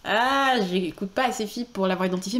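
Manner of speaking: ordinary delivery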